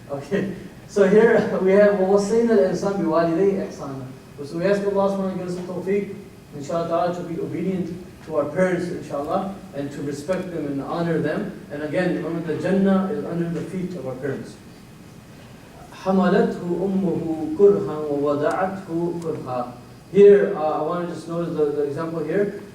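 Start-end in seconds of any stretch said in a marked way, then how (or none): 2.0-4.1 quick chanted Arabic verse, louder; recited Quran verse; Quran recitation. Acted. 15.9-19.7 quick chanted Arabic verse, louder; recited Quran verse; Quran recitation. Acted.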